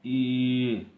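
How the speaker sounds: ई held long, high and loud; teacher sounding out a long ई; pronunciation demo. Shown as a demonstration. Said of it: "ये जो है इसको".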